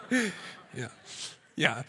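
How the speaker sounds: laughing at his own joke; real laughter. Real, not acted. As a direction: laugh it off, then a wry yeah.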